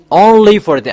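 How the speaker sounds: insistent high stress on "only". Shown as a demonstration. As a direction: hit "only" high to drive it home. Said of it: "I emphasize well".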